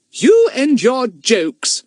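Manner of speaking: slow, mock-stern tut; fond scolding. A narrator's reading. Mother chiding her son over his jokes, slow and mock-stern. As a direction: scold him slowly, half amused.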